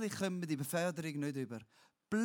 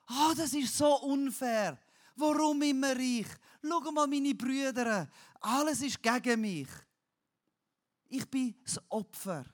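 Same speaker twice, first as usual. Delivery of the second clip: high, loud self-pitying whine; whining complaint; 8 semitones higher, 6 dB louder than usual. Shown as a demonstration. Preacher mimics a whiner complaining to God. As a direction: moan like a sulking victim. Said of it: "Jämmerle heisst".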